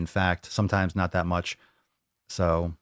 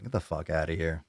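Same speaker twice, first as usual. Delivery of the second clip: ordinary delivery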